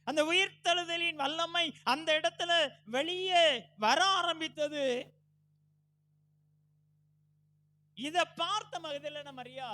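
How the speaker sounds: loud, high pulpit cry; full-force preaching. Real, not acted. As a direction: preach it out with each phrase driven hard.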